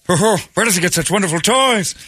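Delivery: squeaky mock Joker line; Joker impression. Jack Nicholson Joker quote.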